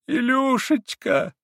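very high, slow, drawn-out cry; wailing mother. A narrator's reading. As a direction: wail her son's name. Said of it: "восклицала она". (с плачем восклицала)

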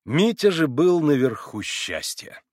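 ordinary delivery, nothing marked

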